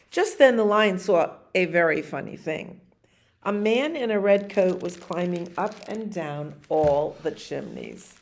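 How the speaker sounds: usual speaking voice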